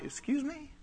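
soft, high incredulous question; affronted disbelief. Acted. God answering someone who wants a miracle, affronted disbelief. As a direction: pitch it right up, quietly taken aback. God taken aback by the demand.

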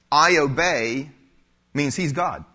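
ordinary talk, nothing marked

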